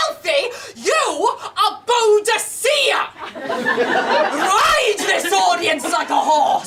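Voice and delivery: high, slow, grandly declaimed mum voice; rally her like a battle cry from the back; imitated rallying mother. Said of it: "My mother just stood up at the back and went".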